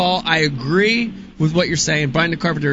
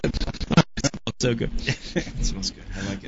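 whispered and slowed, savouring the smell; hushed mock pleasure; 7 semitones lower, 9 dB quieter, slower than usual. Host savouring the pine-scented muff, slow whisper. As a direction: breathe it out slowly like a dreamy sniff. Sniffing pine tar joke.

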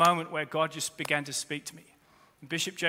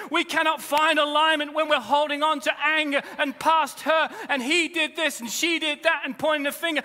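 louder, higher, driving preacher voice over music; preaching at full force; 9 semitones higher, 7 dB louder than usual. Acted. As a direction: build the sermon to a passionate climax.

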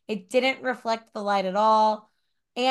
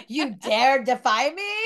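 dark lord; 3 dB louder than usual. Acted. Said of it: "voicing the coffin female".

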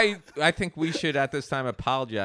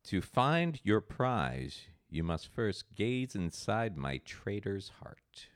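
slow, low and soft; creepy clue reading; 9 semitones lower, 9 dB quieter, slower than usual. Acted. Host reading the treasure clue, slow and creepy. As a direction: intone it like an ominous riddle. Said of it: "like, a creepy guy would say".

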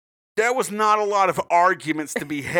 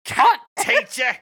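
swearing tirade; 4 semitones higher than usual. Pretend. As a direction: rant at the teacher as a furious chav mum. Voicing Fred's mum yelling at teachers.

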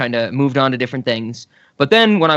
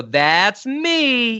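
high, drawn-out character catchphrase; Amanda Bynes sketch catchphrase; 8 semitones higher than usual. Retold or imitated. Podcaster mimics the letter-reading sketch for co-host, sing-out impersonation. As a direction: imitate a sketch character, pitched up and stretched. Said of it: "she'd go".

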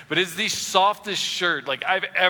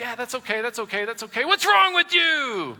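passive-aggressive; 9 semitones higher, 4 dB louder than usual. Retold or imitated. Preacher voices a spouse's passive aggression, mock sweetness. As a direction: fake calm, then drag out the jab. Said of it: "that passive aggressiveness".

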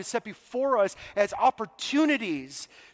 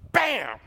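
punchy, raised "bam" of a blow; sudden "bam"; 4 semitones higher, 4 dB louder than usual. Retold or imitated. Preacher acts out Paul being blindsided, sudden smack sound. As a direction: imitate a surprise smack.

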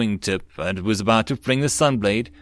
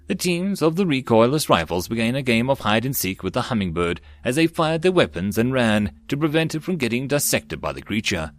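usual speaking voice

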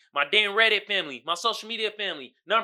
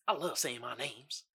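ordinary delivery

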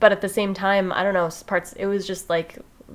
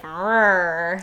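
chainsaw buzz. Retold or imitated. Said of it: "and he's like".

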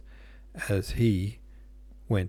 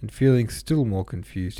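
usual speaking voice

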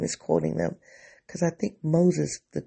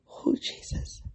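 tearful whispered exhale; 8 dB quieter, slower than usual. Real, not acted. Crying, exasperated.

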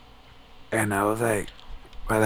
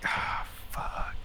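whispered curse on a breath; retold dismayed sigh; 9 dB quieter than usual. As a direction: let it out as a hushed, sinking groan. Buddy reacts, eyes closed, dismayed.

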